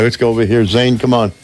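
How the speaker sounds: usual speaking voice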